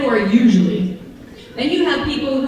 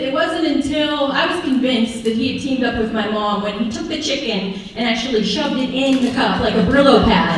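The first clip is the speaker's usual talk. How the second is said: ordinary delivery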